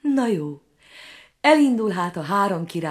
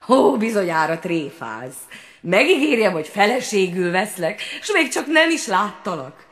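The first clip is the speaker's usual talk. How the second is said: indignant prince; 2 semitones higher, 4 dB louder than usual. A narrator's reading. Prince protests to frog.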